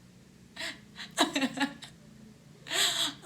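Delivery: high crying laugh. Real, not acted. Laughing at the punchline of her own story, half crying. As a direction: break into a laugh that is half a sob.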